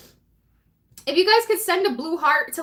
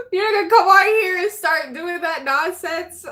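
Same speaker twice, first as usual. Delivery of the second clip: mock scolding; 4 semitones higher, 5 dB louder than usual. Scolding troll in chat.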